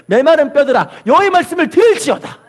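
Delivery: high, commanding declaration; prophetic proclamation. Acted. Preacher voices God's word through Ezekiel to bones, high proclamation. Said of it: "하나님이 선포하시는 거예요".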